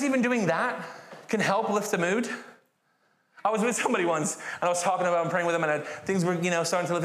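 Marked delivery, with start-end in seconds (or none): none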